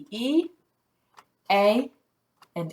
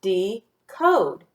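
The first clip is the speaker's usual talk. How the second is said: "Decode" as de, pause, code; "Decode" broken apart at the syllable boundary; split syllables. Shown as a demonstration. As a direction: say "Decode" in two detached syllables. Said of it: "Let's clap the syllables in decode".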